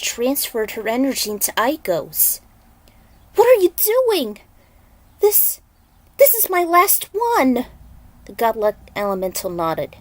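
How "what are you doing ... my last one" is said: put-on high, loud frantic girl's protest; high pleading young heroine; alarmed Aiko. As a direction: she can't believe he took her last gem. Aiko protests losing her gem.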